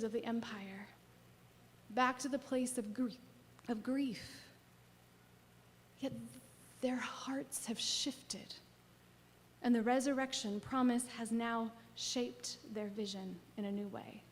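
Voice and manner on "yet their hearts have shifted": slow whisper; hushed and tender. Acted. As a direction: let it land softly and slowly. Tender turn in the sermon.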